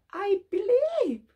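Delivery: high, slow, heartfelt declaration; earnest confession. Acted. Blind man answering Jesus, heartfelt and high. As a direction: say it with wide-eyed faith.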